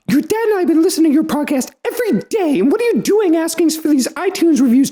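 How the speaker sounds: high mock mom voice, fast; imitating mom. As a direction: play a scolding mom, high-pitched. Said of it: "me trying to sound like Mom".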